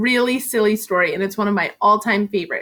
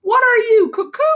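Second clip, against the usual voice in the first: high, louder disbelieving cry; incredulous cry; 14 semitones higher, 5 dB louder than usual. Acted. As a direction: scoff as if he's crazy. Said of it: "cried Murray".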